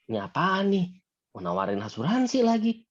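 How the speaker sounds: a lightly voiced annoyed customer who smells another insurance pitch; suspicious prospect.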